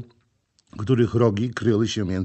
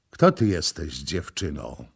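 ordinary delivery

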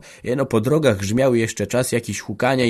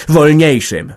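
"Wolniejszym!" yelled louder and higher, falling; husband shouts the final word at his wife; furious cry; 6 semitones higher, 10 dB louder, slower than usual. Acted. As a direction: hurl the last word with full force. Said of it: "w samą twarz powiedział".

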